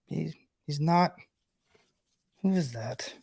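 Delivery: soft, slow wondering to oneself; puzzled aside. Retold or imitated. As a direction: imitate a stranger quietly trying to place someone. Imitating a stranger puzzling over him.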